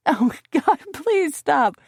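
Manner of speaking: high, breathless laughing plea; choking with laughter. A narrator's reading. Narrator being tickled by Nick, pleading through laughter. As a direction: beg through sputters of laughter. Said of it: "choked out with sputters of laughter".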